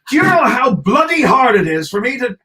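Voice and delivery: loud, high-pitched exasperation; exasperated rant. Acted. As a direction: rant loudly at how hard it is. Comic rant about ordering water.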